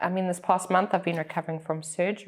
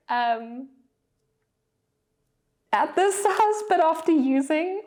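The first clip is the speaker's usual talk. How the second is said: excited; 9 semitones higher than usual. Real, not acted. Excited unboxing tiny planners.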